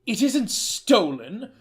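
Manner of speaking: a hint of a stunned grandson; stammered thanks as the pretend grandson; young man's voice. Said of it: "Mister Hayworth said".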